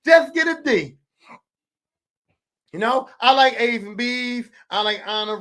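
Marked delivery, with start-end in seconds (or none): 0.1-1.0 exasperated shout. Retold or imitated. Yelling at son about grades.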